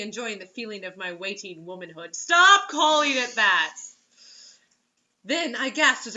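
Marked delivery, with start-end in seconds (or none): 2.1-3.7 exasperated. Real, not acted. Exasperated at the book.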